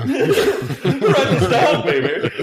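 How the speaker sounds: slow, high, laughing funk-star voice. Retold or imitated. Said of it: "I don't know if I'm doing a good [name]".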